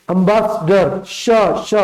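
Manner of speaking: एम्बेसडर drawn out slowly, then its sibilant श isolated; slow एम्बेसडर, followed by separate श sounds; pronunciation demo. Shown as a demonstration. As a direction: break down एम्बेसडर slowly and repeat the hissing श alone. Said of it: "एम्बेसडर हम लोग बोलते हैं".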